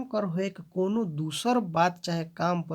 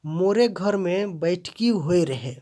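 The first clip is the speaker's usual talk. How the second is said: More louder, the line slowed down; stress on more; 5 dB louder than usual. Shown as a demonstration. Teacher showing pronoun stress, more pushed out. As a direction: hit the pronoun more firmly. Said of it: "सर्वनाम पर जोर देना है".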